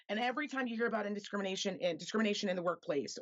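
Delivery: ordinary talk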